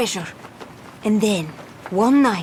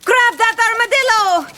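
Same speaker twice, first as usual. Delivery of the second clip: shouted order; 10 semitones higher, 4 dB louder than usual. Acted. Captain orders chase.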